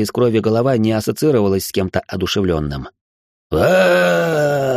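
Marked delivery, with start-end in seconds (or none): 3.5-4.8 monster wail. Acted. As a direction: wail as the head dies. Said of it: "завопила голова".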